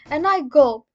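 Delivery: ordinary delivery, nothing marked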